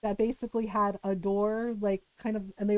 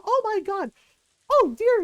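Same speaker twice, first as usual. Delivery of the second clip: high, loud scandalized scream; mock librarian scream; 8 semitones higher, 7 dB louder than usual. Librarian screaming at the scene, hammed-up shriek. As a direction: scream in horror at what she found. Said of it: "the librarian screaming".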